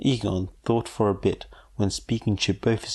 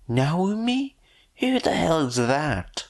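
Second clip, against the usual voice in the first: high, indignant woman's voice; 8 semitones higher than usual. Acted. The mother demanding who Naomi is, high and indignant.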